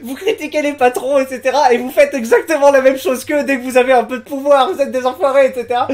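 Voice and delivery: high-pitched indignant accusation; retold outrage. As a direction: act out angry forum users hurling blame. Les mecs disaient. (accusation indignée rapportée)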